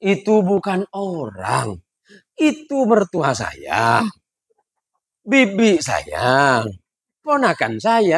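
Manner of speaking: slow, drawn-out insistence; slow stubborn driver. Acted. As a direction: explain it patiently as if obvious. (ngotot pelan-pelan)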